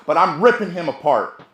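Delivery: usual speaking voice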